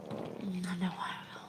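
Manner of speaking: slow, private whisper to herself. Real, not acted. Telling viewers she'll dream about it, slow whisper. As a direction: drop to a breathy slow whisper.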